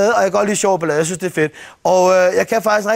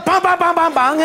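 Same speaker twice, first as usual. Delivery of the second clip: mock gunfire; 6 semitones higher than usual. Mimics shootout on motorway.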